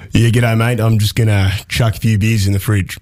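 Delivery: broad Australian accent; Bruce voice. Shown as a demonstration. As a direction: ocker Aussie bloke with an Australian accent. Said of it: "a special voice that's called Bruce".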